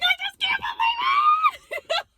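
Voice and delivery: high, loud cry of disbelief; elated yell; joyful shout. Retold or imitated. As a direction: shout it out in joy.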